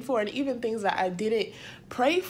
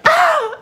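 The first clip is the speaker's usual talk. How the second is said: high, loud mock cry of joy; Grinch heart gasp; 9 dB louder than usual. Vlogger imitating the Grinch's gasp, high and loud. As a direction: squeal like the Grinch as his heart grows. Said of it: "scene in The Grinch when he's like".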